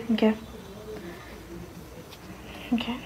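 usual speaking voice

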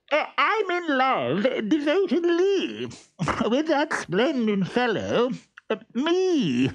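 high, laughing smug voice; vain muffled master. Acted. Stentorello in the trunk, high and laughing at himself. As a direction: preen and chuckle at yourself.

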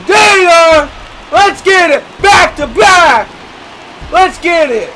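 high, loud hype yells; celebratory shout. Real, not acted. Gamer celebrating back-to-back home runs, celebratory shout. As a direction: whoop and holler in triumph.

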